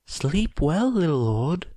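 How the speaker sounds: ordinary delivery, nothing marked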